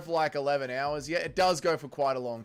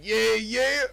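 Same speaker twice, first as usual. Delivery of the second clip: mock American yeah yeah; 7 semitones higher, 7 dB louder than usual. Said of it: "you're from America".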